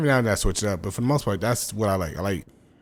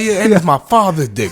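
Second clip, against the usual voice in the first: high, loud comeback; mock defiant yell; 11 semitones higher, 10 dB louder than usual. Man retelling his father's face-off, mock defiant yell. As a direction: shout it back as a hammed-up boast. Acting out heated exchange in story.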